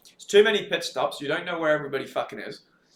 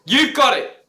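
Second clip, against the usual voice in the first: high, loud cheer; mock quiz host cheer; 9 semitones higher, 7 dB louder, slower than usual. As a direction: hail the correct answer.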